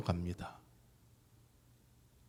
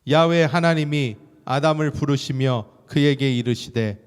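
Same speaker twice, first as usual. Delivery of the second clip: slow, weighty reading of the verse; solemn scripture reading; 3 semitones higher, 8 dB louder, slower than usual. Acted. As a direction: read it slowly, with gravity. Preacher reading scripture slowly.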